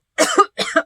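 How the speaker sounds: hard sudden cough. Real, not acted. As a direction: cough up a bug. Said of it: "Coughing up bugs".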